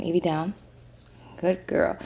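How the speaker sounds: soft, low command to a dog; quiet dog command. Real, not acted.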